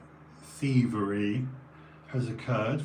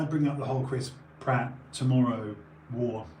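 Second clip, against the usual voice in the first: ordinary talk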